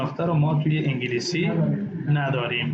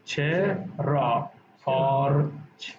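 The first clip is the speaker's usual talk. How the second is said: slow demo; 2 semitones lower, much slower than usual. Shown as a demonstration. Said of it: "ببین".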